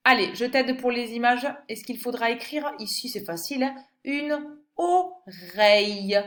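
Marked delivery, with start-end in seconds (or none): 4.0-6.2 "oreille" drawn out slowly, stressed; slow stressed word. Shown as a demonstration. Teacher to pupil, naming the picture slowly. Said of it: "helping with the pictures".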